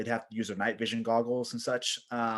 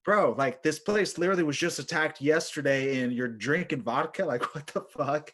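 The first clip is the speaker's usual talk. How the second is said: higher-pitched acted disbelief; incredulous; 4 semitones higher, 4 dB louder than usual. Narrator to vodka-drinking soldier after attack, mock incredulity. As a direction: question the madness, half amused, acted out.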